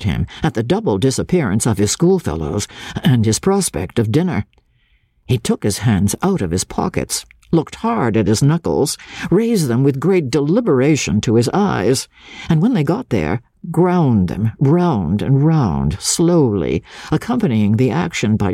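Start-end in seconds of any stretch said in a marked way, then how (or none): none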